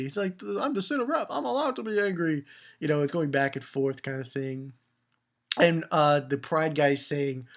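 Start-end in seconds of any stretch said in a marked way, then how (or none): 0.4-2.4 high, put-on protest; mock defiant. Kid playing Wrath defending his anger, mock defiance. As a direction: insist in a raised, hammy voice.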